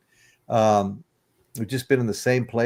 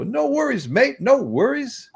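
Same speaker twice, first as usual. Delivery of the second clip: much higher, drawn-out Aussie "No worries, mate"; Australian accent. Retold or imitated. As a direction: imitate an easygoing Australian. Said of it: "and he'd go".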